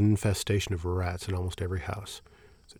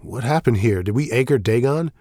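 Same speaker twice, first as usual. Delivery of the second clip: urgent; 3 semitones higher, 8 dB louder than usual. Acted. Priest demands answers.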